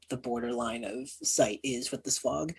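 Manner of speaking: normal talking voice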